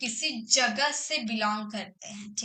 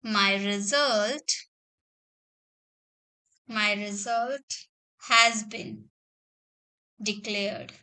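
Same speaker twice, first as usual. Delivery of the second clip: slow, word-by-word dictation with long pauses; a teacher dictating each word slowly; 3 semitones lower, much slower than usual. Shown as a demonstration. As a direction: read it out slowly so students can write it down. Teacher dictates example sentence.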